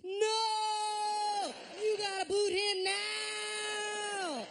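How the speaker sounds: high, drawn-out mock scream; hammed-up desperate yell, stretched out; all-caps shout. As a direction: bellow it like a typed outburst. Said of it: "Harris writes in all caps".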